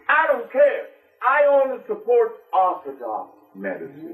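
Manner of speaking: a high, dismissive retort; indignant official. Retold or imitated. Politician rejecting herbal healing, high and curt. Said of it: "she said".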